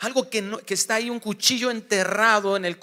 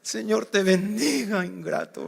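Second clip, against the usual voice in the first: sarcastic blessing; 2 semitones higher than usual. Acted. Biting blessing to the offender.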